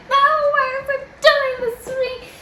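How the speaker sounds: very high mock-crying protest; hysterical wail. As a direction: wail it, refusing all comfort. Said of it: "I'm hysterical".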